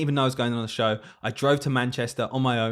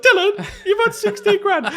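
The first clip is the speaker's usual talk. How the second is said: high, slow, laughing-crying mum; 8 dB louder than usual. Retold or imitated. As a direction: imitate mum overwhelmed with tearful joy. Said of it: "My mum's, like, crying".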